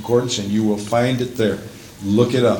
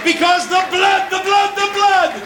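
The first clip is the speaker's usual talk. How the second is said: impassioned revival-preacher climax; fervent preaching. Real, not acted. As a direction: build from raised pitch to high, shouted preaching. Preaching climbs to full force.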